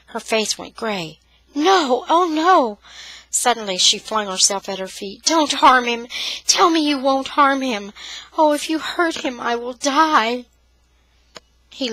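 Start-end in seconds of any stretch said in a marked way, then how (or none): 1.5-2.8 high, pleading gasp; frightened cry. Acted. Sister begging for the soldier, frightened cry. 5.2-10.5 desperate plea. Acted. Sister pleading at his feet.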